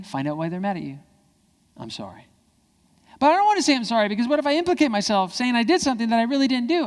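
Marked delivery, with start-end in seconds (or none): 1.8-2.3 low, soft sorry; hushed, sincere apology; simple apology. Shown as a demonstration. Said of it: "how to do a simple apology". 3.2-6.9 high, whiny mock objection; pitched-up, loud kid protest; protesting child.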